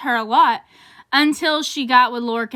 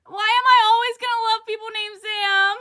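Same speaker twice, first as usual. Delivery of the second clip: fan anguish; 8 semitones higher than usual. Real, not acted. Distressed fan.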